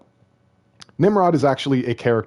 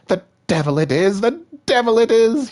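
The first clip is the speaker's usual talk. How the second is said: shrieking villain, much higher and louder; furious scream; 13 semitones higher, 5 dB louder than usual. Acted. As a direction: scream it in a rage, rising each time. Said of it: "he screamed and stamped his foot".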